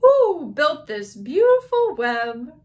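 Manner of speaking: high, loud, slow owl voice; owl asking. Acted. Owl asking who made the web, slow hooting voice. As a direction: hoot the "who" and stretch the question. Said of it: "asked the owl".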